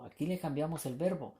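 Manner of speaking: ordinary talk, nothing marked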